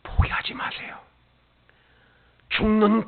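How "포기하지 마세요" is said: whisper. Acted. Preacher whispering 포기하지 마세요 to the congregation. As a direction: breathe 포기하지 마세요 as a hush. Whispered then repeated aloud.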